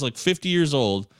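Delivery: ordinary talk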